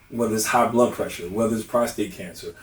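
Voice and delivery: usual speaking voice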